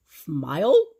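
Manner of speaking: loud, puzzled echo; surprised officer. A narrator's reading. Officer baffled by Grandpa's request, loud rise. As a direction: repeat it in disbelief.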